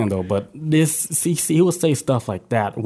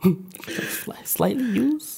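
high, soft disbelieving echo; sarcastic soft repeat; mock incredulous; 9 semitones higher, 6 dB quieter, much slower than usual. Mocking repeat of used.

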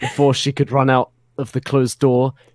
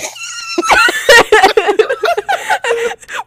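shrieking cracked-up laugh; high squealing laugh; 5 dB louder than usual. Real, not acted. Reader loses it at the joke, high squeal.

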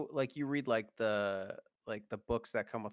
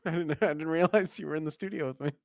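high, fast, laughing feigned shock; mock surprise; 5 semitones higher, 8 dB louder than usual. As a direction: act stunned he's in the room. Mock surprise at cohost presence.

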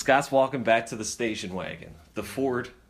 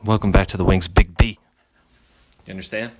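loud show host intro; big announcer welcome; radio announcer; 9 semitones higher, 9 dB louder than usual. Acted.

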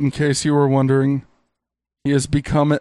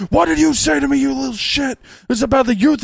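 higher, lightly yelled quote; mock yell; 8 semitones higher than usual. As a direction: yell it like an angry streamer. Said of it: "he yells".

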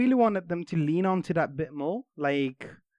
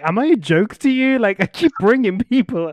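higher and louder, offended whine; indignant spell voice; 7 semitones higher, 8 dB louder than usual. Pretend. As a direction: play the spell fed up and insulted. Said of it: "The seeking spell is like".

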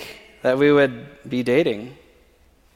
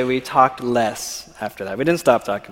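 usual speaking voice

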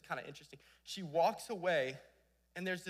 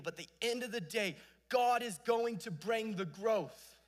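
emphatic preaching close; 5 semitones higher, 6 dB louder than usual. Acted. Preacher closes point with weight.

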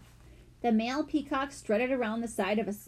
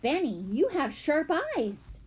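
higher-pitched impressed exclamation; mild gasp; 7 semitones higher than usual. A narrator's reading. Jessie praising her brother's find, lightly astonished. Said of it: "Jessie gasped".

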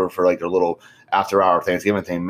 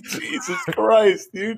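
exasperated laugh; 12 semitones higher, much slower than usual. Retold or imitated. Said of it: "I'm like".

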